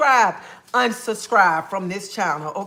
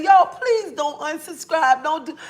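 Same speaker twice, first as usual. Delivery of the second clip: high, slow mock begging; hammed-up plea, pitched high; 2 mock pleading; 6 semitones higher than usual. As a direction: beg like a fan is leaving. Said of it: "I'm finna get on here and say".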